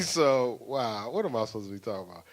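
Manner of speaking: usual speaking voice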